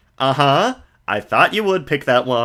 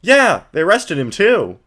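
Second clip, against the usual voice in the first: put-on higher, brighter girl voice; Maya voice; 8 semitones higher, 5 dB louder than usual. Streamer voicing Maya to the viewers, girlish and high. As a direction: play an eager young girl.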